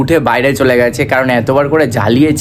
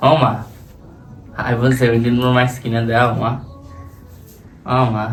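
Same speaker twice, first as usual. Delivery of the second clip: whiny plea; 6 dB quieter, slower than usual. Real, not acted. Son whining at mother.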